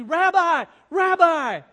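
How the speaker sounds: very loud, high drawn shout; breathless crying out. Acted. Simon runs after his rabbi, crying out urgently. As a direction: call desperately after someone getting away. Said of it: "crying breathlessly".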